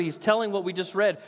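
ordinary delivery

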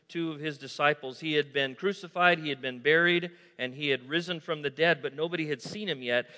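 usual speaking voice

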